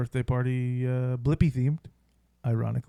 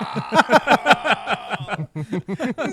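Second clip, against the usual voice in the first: laughing shout; 8 semitones higher, 5 dB louder than usual. Real, not acted. Laughing while imitating tantrum.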